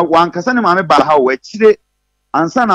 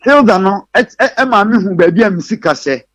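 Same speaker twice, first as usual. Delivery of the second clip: agitated raised voice; 6 semitones higher than usual. Real, not acted. Woman pressing Hilda about her missing money, agitated and raised. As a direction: demand an answer, voice pitched up.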